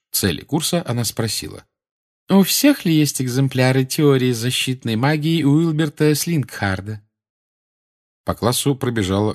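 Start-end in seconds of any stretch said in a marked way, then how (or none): 2.3-7.0 high, saccharine lecturing; sugary Umbridge voice. A narrator's reading. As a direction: lecture with condescending sweetness. Umbridge asks.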